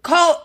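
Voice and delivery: "Coat" high, ending in a glottal stop, no T. Shown as a demonstration. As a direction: swallow the last T of "Coat". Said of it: "you said no T".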